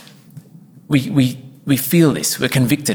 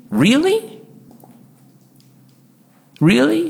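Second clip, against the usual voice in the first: high, sharply rising disbelief; pitched-up incredulous question; incredulous really; 13 semitones higher, slower than usual. Real, not acted. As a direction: challenge it with mock astonishment, way up high. Pastor mocks cheerleader reply.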